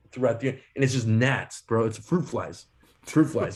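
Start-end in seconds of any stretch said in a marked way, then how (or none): none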